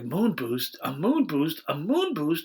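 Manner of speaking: escalating mock amazement; mock repeated surprise. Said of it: "they're like".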